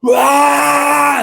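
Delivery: loud, high, raw scream, a short burst; unleashed scream. Retold or imitated. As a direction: let out one wild primal yell. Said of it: "unleashed this unmerciful".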